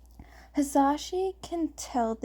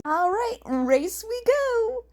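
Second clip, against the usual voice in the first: enthusiastic cheer; 11 semitones higher, 4 dB louder than usual. A narrator's reading. Shota cheers at the racing game, matching the kids, high and excited. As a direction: pump it up like a kid at the arcade. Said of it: "matching the kids' enthusiasm".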